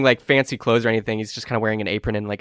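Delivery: usual speaking voice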